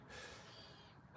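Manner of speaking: soft breathy sigh. Real, not acted. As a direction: let out a quiet breath. Sighs while winding up.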